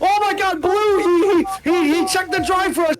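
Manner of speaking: high, loud spoiler panic; excited shouting. Real, not acted. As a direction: yell at chat not to look. Caster panics over a spoiler.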